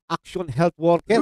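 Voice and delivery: usual speaking voice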